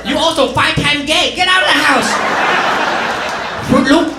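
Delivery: high scolding mom voice. Retold or imitated. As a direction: throw the kid out like mom. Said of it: "mom reacts".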